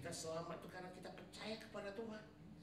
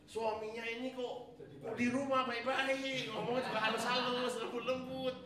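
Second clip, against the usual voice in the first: higher, louder, slowed mock wonder of a wife; amazed wife; 7 semitones higher, 7 dB louder than usual. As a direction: play the astonished wife marvelling. Voicing wife's amazed thoughts.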